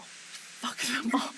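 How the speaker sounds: hushed, breathless curse; a soft unvoiced aside; whispered. Real, not acted. Angry venting, whispered aside.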